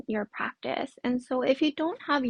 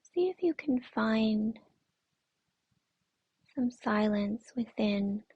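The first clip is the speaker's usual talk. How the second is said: guided meditation. Acted. Yoga teacher centering the class on their breath, guided meditation. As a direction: guide them gently inward, unhurried and soothing.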